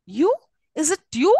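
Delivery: "you" rising high at the end of each phrase. Shown as a demonstration. Teacher demonstrating the tonic syllable, rising tone on "you". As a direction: lift the last "you" into a query. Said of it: "a rising tone".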